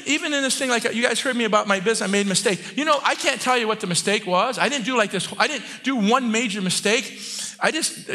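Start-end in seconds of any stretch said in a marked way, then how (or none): none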